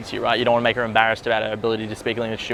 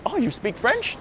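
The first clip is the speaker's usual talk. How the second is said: mock impressed question, much higher and softer; light girlish surprise, pitched way up; imitating her surprise; 11 semitones higher, 5 dB quieter than usual. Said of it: "She's like".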